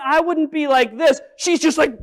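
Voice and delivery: ordinary delivery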